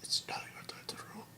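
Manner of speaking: very faint, quick whisper; dying king. Retold or imitated. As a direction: imitate a dying king's hushed last words. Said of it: "the king is like".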